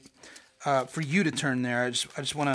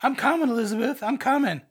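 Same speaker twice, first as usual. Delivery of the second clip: higher, mock heart-attack Fred Sanford; lightly voiced melodramatic old man; Sanford impression; 9 semitones higher, 4 dB louder than usual. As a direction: clutch your chest and fake dying. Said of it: "Sanford and Son".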